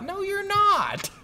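very high, drawn-out mock protest; incredulous retort. Player contradicting the game's bleak ending, incredulous. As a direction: stretch it out in loud, high disbelief.

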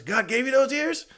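high, loud impression of a bandleader. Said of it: "[name]'s telling him".

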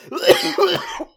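harsh real coughing fit. Real, not acted. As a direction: cough hard, unable to stop. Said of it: "Why am I coughing so much?".

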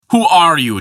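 giant's high, loud demand to the intruder. A narrator's reading. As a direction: challenge the stranger hard. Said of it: "demanded Vafthrudnir".

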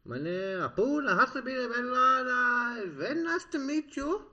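high, slow mock Pakistani accent; hammed-up Pakistani accent. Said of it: "Pakistani, sorry".

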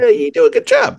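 high squawky voice. Pretend. Teasing in the impression.

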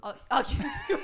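words breaking into laughter; laughing. Real, not acted. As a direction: laugh loud through the words. Bursts out laughing.

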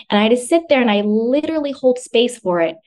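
normal talking voice

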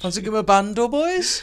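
high, loud mock player; hammed-up eager invite; playful acted invitation. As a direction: call out to the lads in a silly high voice. Acting out players inviting friends.

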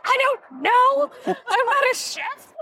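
high, slow whiny protest; whiny kid. Retold or imitated. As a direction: stretch it out like a sulky eight-year-old. Child answering grandma, retold.

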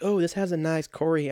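high cartoon-character voice; Herbert. Host mimicking a kid describing a toy, odd cartoon voice. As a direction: lift the pitch into a silly put-on voice. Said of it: "he sounds like fucking Herbert".

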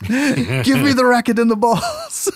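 high, slow mock-heroic command; heroic Putman. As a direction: demand it like an action hero.

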